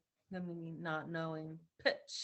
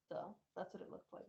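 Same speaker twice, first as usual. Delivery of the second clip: soft near-whisper; 2 semitones higher, 11 dB quieter than usual. Real, not acted. Showing the implant to family, a soft near-whisper aside. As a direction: drop to a breathy half-voice, softer. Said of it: "Oh, by the way".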